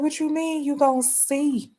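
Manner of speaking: high, outraged disbelief; incredulous. Retold or imitated. Driver retorting to young Browns fan, high and stunned. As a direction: throw it back at her, stunned. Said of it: "I said".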